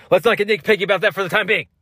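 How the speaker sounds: fast, high-pitched, hammed-up character voice; old-style put-on voice. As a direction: race through it in a high put-on voice. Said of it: "just talk like that old".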